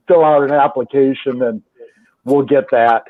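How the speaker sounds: usual speaking voice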